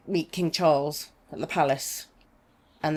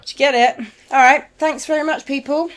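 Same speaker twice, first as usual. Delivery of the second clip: podcast sign-off; 9 semitones higher, 7 dB louder than usual. Acted.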